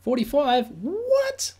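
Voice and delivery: shooting up high in disbelief; startled high-pitched double take; surprised. Real, not acted. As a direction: react like you can't believe the number. Reacting to the viewer count.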